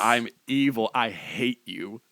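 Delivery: low, slowing evil voice; mock villain. Co-host voices the banned impressionist's reply, mock-evil and low. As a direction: drop deep and drag out the menace. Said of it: "And he was like".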